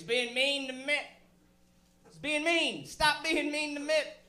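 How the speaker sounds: high, whiny mock complaint; whiny pundit. Mocking news pundits whining that Obama is mean, high whine. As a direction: whine like a pearl-clutching pundit.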